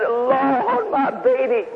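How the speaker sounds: lightly voiced mother, high and pleading; a hint of a mother crying out for her child; mother's cry. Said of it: "She said".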